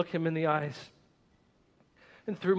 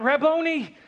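much higher and louder joyful cry; cried out; 10 semitones higher, 7 dB louder than usual. A narrator's reading. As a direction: cry the name out in joy. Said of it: "I cried out".

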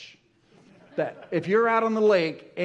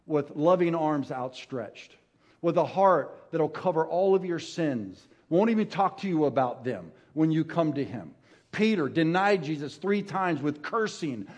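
normal talking voice

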